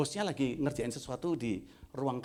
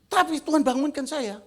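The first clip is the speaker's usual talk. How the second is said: higher, loud imitated old man; a raised-voice take on the doubting man; mimicking the man; 12 semitones higher, 9 dB louder than usual. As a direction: act out the skeptical man, raised voice. Quoting the man's story.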